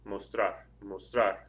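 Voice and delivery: slow "mostrar", stressed on the last syllable "trar"; stress on final AR. Shown as a demonstration. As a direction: stretch the "ar" ending of "mostrar". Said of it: "The stress is over the A R".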